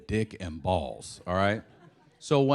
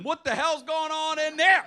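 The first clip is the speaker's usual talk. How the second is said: neighbor yelling in. Retold or imitated. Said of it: "she yells in".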